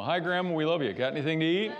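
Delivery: a hungry grandkid at the door; kid greeting Grandma. Retold or imitated. Grandkid greeting Grandma at her house, hoping for food, high and eager. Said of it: "you know".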